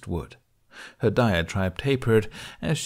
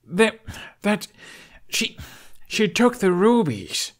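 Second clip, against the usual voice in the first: stammering shock; 11 semitones higher, 4 dB louder, slower than usual. A narrator's reading. Stammering realization of theft.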